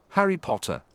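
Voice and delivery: lilting, teasing call from downstairs; sing-song call. A narrator's reading. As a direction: sing the name up the stairs. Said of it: "A sing-song voice called".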